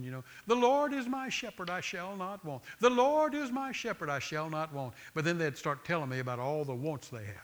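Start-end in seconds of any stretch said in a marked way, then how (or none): 0.5-4.9 high, whiny mock recital. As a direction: whine it like a self-pitying complainer. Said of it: "kind of in a whiny tone".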